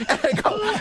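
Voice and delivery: normal talking voice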